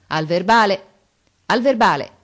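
loud, cheery parting call. A narrator's reading. Two fathers parting after the exam, calling out loud. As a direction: call it across as you walk away.

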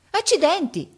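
very high, loud outburst; startled exclamation. A narrator's reading. Boy hears bullet pass, exclaiming. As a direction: exclaim as if just shot at. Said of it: "esclamò".

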